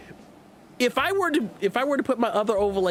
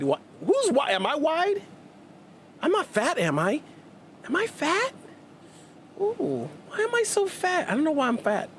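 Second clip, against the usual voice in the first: mock offended whine; 9 semitones higher than usual. Mock hurt over being called wide.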